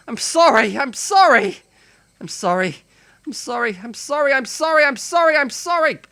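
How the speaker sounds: high, loud desperate apologies; frantic pleading. Acted. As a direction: beg them not to leave. Hero begs the fleeing cicadas.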